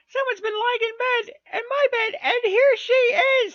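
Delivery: very high, loud, shrill tiny bear; little small voice. A narrator's reading. Wee bear finds the intruder in bed, shrill squeal. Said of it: "in his little small voice".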